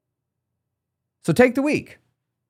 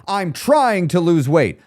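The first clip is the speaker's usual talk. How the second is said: slow, higher-pitched confident declaration; 5 semitones higher, 4 dB louder, slower than usual. Shown as a demonstration. Coach comparing how the phrases feel, confident. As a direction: stretch it out with conviction.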